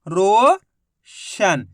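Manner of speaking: Roshan stretched very slowly into one word; drawn out blend. Shown as a demonstration. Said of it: "तो मिलके बन गया".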